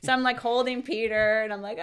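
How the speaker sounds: ordinary talk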